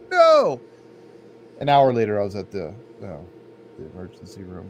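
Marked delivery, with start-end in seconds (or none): 0.1-0.6 high, loud flat no; digging in your heels; stubborn refusal. Retold or imitated. Stubborn patient refusing the hospital, a high loud no.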